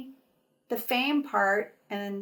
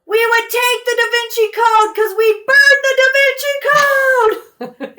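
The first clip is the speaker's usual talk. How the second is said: high, full-volume holler; group of boys yelling; 13 semitones higher, 12 dB louder than usual. Retold or imitated. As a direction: bellow it like rowdy fans, stretching the last word. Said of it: "this group of boys yell".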